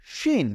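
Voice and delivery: শিন said high, the sh sound clear; letter name shin, high. Shown as a demonstration. Teacher announcing the letter শিন to students, high. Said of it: "এটি হলো".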